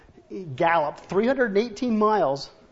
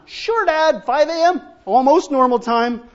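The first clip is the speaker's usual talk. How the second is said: higher, louder mock teen sarcasm; sarcastic son; 6 semitones higher, 8 dB louder than usual. Son mocking his father's early start, sarcastic and loud. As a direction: roll your eyes at Dad.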